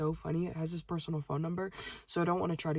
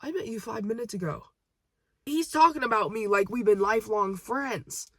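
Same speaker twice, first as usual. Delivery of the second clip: high, exclaiming, getting louder; incredulous protest; 4 semitones higher, 7 dB louder than usual. Real, not acted. Cashier on a stranger acting like a friend, incredulous. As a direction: protest in disbelief, pitch up.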